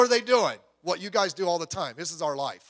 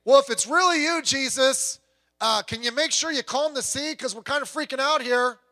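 mock panicky plea; 6 semitones higher, 4 dB louder than usual. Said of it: "He didn't say".

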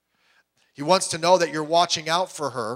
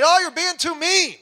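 much higher and louder, mock nagging wife; mock scolding wife; 13 semitones higher, 6 dB louder than usual. Preacher voices a wife scolding her husband over the kids, in a high mock nag. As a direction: whine it like a put-out wife.

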